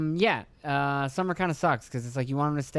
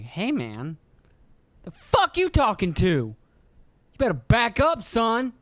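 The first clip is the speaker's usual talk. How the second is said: higher, louder, slow warning; mock dad threat; 7 semitones higher, 4 dB louder, slower than usual. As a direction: imitate a dad squaring up to his kid. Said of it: "And I'd be like".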